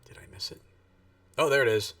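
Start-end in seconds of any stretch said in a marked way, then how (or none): 0.0-0.6 whispering. Real, not acted. Checking for missed event.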